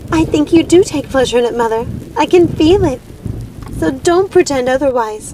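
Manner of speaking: put-on high, accusing young girl's voice; defiant daughter. Primrose accusing her mother of enjoying the killing, defiant and high. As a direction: call her mother a liar to her face.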